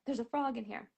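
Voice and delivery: very soft retold aside; hushed announcement. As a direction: tell passers-by under your breath. Retold telling passers-by, hushed.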